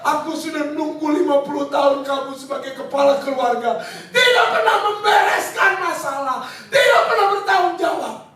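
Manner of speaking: retold furious wife; angry wife shouting. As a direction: throw the blame back at him. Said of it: "Istrinya lebih marah lagi".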